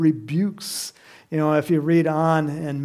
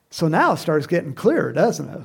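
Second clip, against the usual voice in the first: normal talking voice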